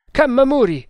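high, loud call; shouted summons. Acted. Tremal Naik summons his helper to the torture, shouting. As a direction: call out sharply to your helper.

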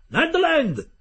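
a high, loud shout across the deck; loud call. Acted. The captain summons the harpooner with a loud, high call. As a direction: call Ned Land out over the noise. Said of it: "chiamò".

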